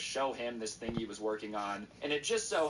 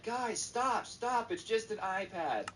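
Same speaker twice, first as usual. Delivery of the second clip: high-pitched frantic plea; hammed-up, squeaky-high exasperation; exasperated plea; 10 semitones higher, slower than usual. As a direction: act out begging a mob to stop. Cousin pleading with swarming kids.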